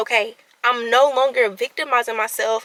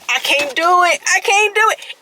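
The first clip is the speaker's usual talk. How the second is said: high, rising panicked I can't do it; imitating her own panic, pitched up; retold panic; 7 semitones higher, 4 dB louder than usual. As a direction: relive the freak-out in a higher voice. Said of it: "I said".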